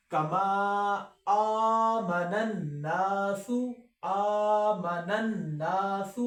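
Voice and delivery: slow melodic tajweed recitation; Quran recitation. Shown as a demonstration. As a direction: recite slowly and carefully.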